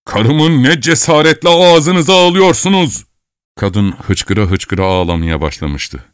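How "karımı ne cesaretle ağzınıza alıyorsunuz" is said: louder, slow outraged shout; old man shouting. A narrator's reading. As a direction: roar it at the intruder. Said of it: "diye bağırmaya başladı ihtiyar". (ihtiyar öfkeyle bağırıyor)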